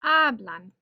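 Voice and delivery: "Hablan" with a loud first syllable; stress on first syllable. Shown as a demonstration. Teacher demonstrating they speak, "Hablan" stressed on second-to-last syllable. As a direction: punch the "Ha" of "Hablan". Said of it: "Stress on that second to last syllable".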